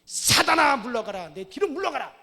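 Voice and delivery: loud, high shouted command; thundering rebuke. Retold or imitated. Jesus rebuking Satan through Peter, thundering shout. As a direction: roar it at Satan. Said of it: "예수님이 뭐라 그럽니까".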